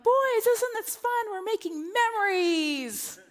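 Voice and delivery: high, slow, sing-song fake cheer; forced cheer. Mother hiding panic from her boys, fake-cheerful and slow. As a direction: sell the chaos as fun to the kids.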